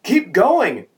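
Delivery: high, loud urging shout. A narrator's reading. Buddy urging the driver to flee, shouting. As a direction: call it out like a panicking buddy. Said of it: "one of his buddies shouted".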